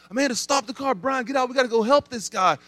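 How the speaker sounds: high, urgent call to stop the car; husband urging wife and friend to help, alarmed. Retold or imitated. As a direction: snap into emergency mode, pitched high. Urgent commands seeing bear attack.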